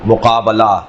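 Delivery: مقابلہ sounded mu-qaa-ba-la; model word pattern. Shown as a demonstration. Teacher drilling words of one pattern, model pronunciation of مقابلہ with "ba". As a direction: give مقابلہ an open "ba", as a standalone example. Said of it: "اس وزن کے اور بہت سارے الفاظ".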